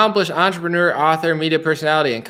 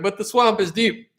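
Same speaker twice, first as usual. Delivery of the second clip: dry, knowing sarcasm; sarcastic; 5 semitones higher than usual. Retold or imitated. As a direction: say it with a wink. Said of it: "being sarcastic and being like".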